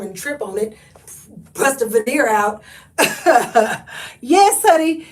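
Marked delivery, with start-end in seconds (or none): 4.2-5.1 loud, high "yes honey"; bursting out with delight; hyped reply to a comment; excited. Real, not acted.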